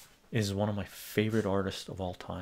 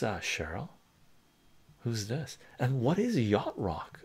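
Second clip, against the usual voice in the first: ordinary talk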